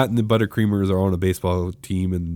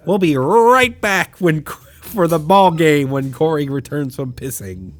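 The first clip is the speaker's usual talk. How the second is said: mock sportscaster; 7 semitones higher, 4 dB louder than usual. Friend mocking a commentator teasing the absent host. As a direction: throw to commercial like a cheesy announcer. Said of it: "a discount Vin Scully who is commentating this".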